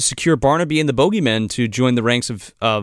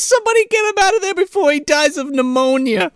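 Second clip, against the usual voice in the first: high, hammed-up tearful wail; mock crying; faster than usual. Stanny pleads for his drowning friend, mock crying. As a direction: wail it like a cartoon crybaby. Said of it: "He starts crying".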